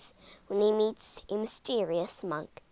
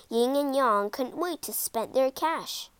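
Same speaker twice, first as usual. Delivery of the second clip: plain reading voice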